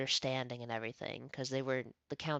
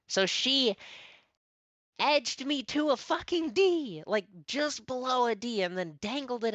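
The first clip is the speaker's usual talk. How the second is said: higher, louder outraged telling; indignant; 10 semitones higher, 5 dB louder than usual. Real, not acted. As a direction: spit out the injustice. Angry retelling of grade.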